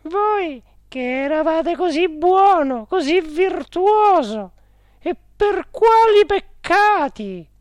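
high, loud, slow woman's lament; Geltrude exclaiming. A narrator's reading. Directress to her dead uncle in purgatory, exclaiming high. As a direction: wail the question in a high woman's voice. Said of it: "esclamò la signora Geltrude".

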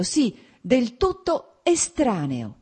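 slow, high, bitter emphasis on estraneo; pained and bitter. A narrator's reading. Wife naming him a stranger, slow, pained and bitter. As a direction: let estraneo land slow with pain and rancor. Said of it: "con pena e rancore".

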